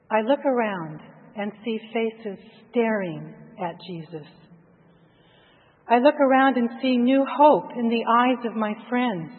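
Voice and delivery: usual speaking voice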